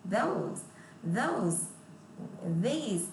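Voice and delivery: "Those" and "these" with tongue between teeth on th. Shown as a demonstration. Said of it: "chữ thờ lè lưỡi là đưa cái lưỡi ra". (đọc "those", "these" lè lưỡi ở âm th)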